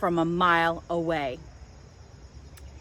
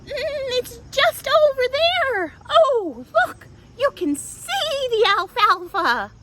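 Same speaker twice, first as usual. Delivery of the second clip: very high, sweet coaxing squeak; tiny coaxing goat. Acted. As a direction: lure them over with a high little voice. Petunia coaxing her siblings across.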